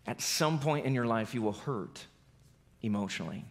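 preacher softly pressing the point home; hushed. Real, not acted. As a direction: let it fall to a near hush. Preacher drops to intimate hush.

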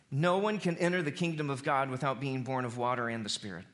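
usual speaking voice

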